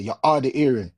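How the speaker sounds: Jamaican accent, "hard" and "hearing" said as 'ard and 'earing; Jamaican saying. Shown as a demonstration. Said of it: "as we say in Jamaica".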